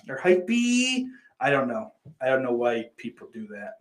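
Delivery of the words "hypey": "hypey" with a long, high final E; stretched final E. Shown as a demonstration. Streamer mocking chat's spelling, "hypey" ending in a high "ee". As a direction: draw out the end of "hypey" as a high "ee". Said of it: "it's not doing what you think it's doing".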